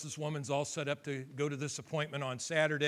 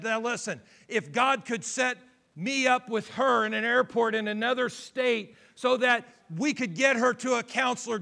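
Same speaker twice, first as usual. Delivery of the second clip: loud, high, fervent preacher delivery; preaching at full force; 8 semitones higher, 9 dB louder than usual. Acted. Pastor driving home the story to the congregation, loud fervent preaching.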